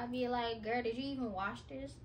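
ordinary talk

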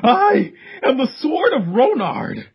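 high, proclaiming hero voice; grandiose hero voice. Acted. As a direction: declare it like a deluded champion. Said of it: "that is my head canon voice".

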